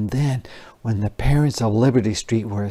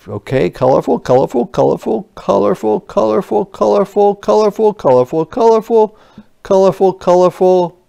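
higher, quick repetitive checking; searching soldier; 8 semitones higher, 5 dB louder, faster than usual. Acted. Soldiers checking each house on Liberty Street, brisk repetition. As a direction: tick off each house, pitched up.

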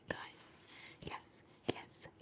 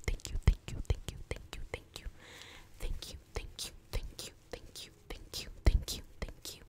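rhythmic ASMR whisper, one tap per syllable; syllable-synced whisper; 9 dB louder than usual. Shown as a demonstration. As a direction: whisper each syllable in time with taps. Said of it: "I'm syncing my words with my taps".

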